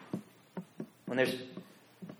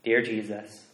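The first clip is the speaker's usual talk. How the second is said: prayer; 3 semitones lower, 5 dB quieter than usual. Acted. Pastor leading the congregation in a soft prayer. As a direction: pray it quietly and humbly.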